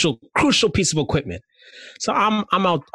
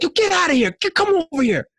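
put-on yelling at girl. Yelling at a little girl in the pool, high urgent yell. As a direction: yell way up high to get her out. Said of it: "I yelled at her".

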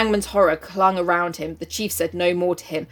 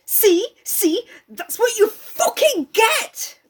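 high, drawn-out mock taunt; gloating Jack; 4 dB louder, much slower than usual. Reader sending up Jack's gloating, mock taunting. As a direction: crow it with exaggerated triumph. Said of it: "this is what Jack says".

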